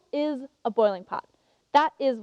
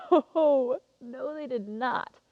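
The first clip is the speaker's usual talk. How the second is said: laughing denial; much slower than usual. Acted. Contestant answering her own question about the founders, amused denial. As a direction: laugh off the very idea, then settle slowly.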